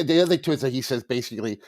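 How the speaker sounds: ordinary talk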